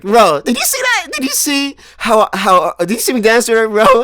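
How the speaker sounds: can't contain it, bro did you see; excited brag. Retold or imitated. To his friends after the dance, excited bragging.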